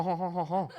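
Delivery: usual speaking voice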